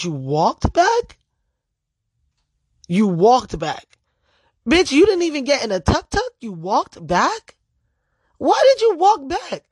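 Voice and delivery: stretched-out disbelief, rising to a high pitch; retold exasperated, incredulous questioning; incredulous scolding. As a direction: question them like you can't believe it. Acted scolding of the friend.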